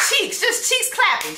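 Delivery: high sing-song mockery. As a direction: squeak it out mockingly. Mocking voice continues.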